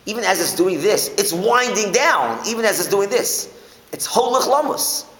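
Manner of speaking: high, loud, driving emphasis; stretched-out, forceful high delivery; emphatic insistence. Real, not acted. Driving home the wind-up toy point.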